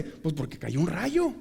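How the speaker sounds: ordinary talk, nothing marked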